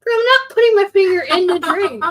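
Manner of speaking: high, loud protest; indignant protest. Real, not acted. Defending own way of pouring a drink, indignant protest. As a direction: push back at teasing, voice jumping up. Playful protest at sister's teasing.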